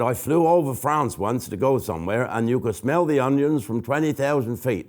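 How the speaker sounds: ordinary talk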